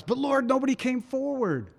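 high, pleading protest to the Lord. Retold or imitated. Preacher excusing himself to the Lord, high pleading whine.

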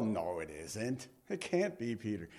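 doubting scoff. Retold or imitated. Doubting believers answer the girl.

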